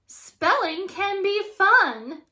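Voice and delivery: high, loud beaming monster teacher. A narrator's reading. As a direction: beam it falsely sweet. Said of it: "beams Missus Green".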